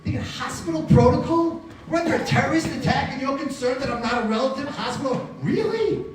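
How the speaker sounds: high-pitched, indignant disbelief; outraged questioning, climbing highest on really; incredulous. Retold or imitated. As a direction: throw the rule back as absurd. Indignant reply to the rule.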